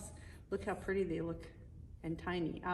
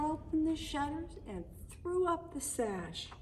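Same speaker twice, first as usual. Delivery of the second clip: lilting read-aloud poem voice; storybook narration. Acted. Parent reading the Christmas poem to the kids, lilting storybook narration. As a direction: read the rhyme to the children like a bedtime story.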